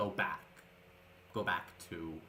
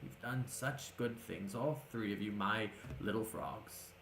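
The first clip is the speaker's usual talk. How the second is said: tender proud mentor; 7 dB quieter than usual. Acted. Old mentor praising his grown pupils, tender. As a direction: let the pride soften your voice. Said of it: "for a moment he goes".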